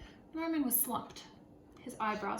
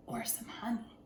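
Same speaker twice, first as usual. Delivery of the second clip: whispered advice; 5 dB quieter than usual. Acted. Animals advising Jim.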